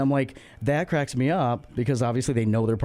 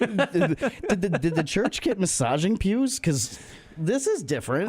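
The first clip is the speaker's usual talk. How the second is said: higher, slow pleased wonder; mock delighted; 7 semitones higher, slower than usual. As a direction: play pleasantly surprised. Acting pleased churchgoer.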